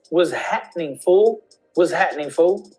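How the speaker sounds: "happening" as "hat'nin" with a catch in the throat; "fool" ending without a firm L; glottal pause. Shown as a demonstration. Teacher modelling the greeting for learners, with glottal pause. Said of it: "There's a glottal pause".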